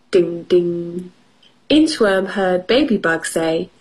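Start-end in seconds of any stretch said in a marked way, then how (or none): none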